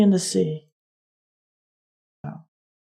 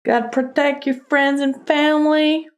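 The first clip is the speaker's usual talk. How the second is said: high-pitched mock Texas accent; 7 semitones higher than usual. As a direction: put on an amateur Texan twang, pitched up. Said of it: "That's my Texas accent".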